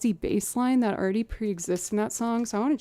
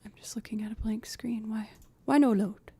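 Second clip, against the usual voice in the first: whispering; 4 dB quieter than usual. Real, not acted. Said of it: "whispering at slow computer".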